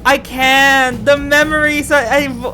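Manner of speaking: ordinary talk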